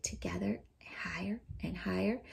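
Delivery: soft suspense whisper; hushed storyteller whisper. A narrator's reading. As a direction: breathe it out, building suspense.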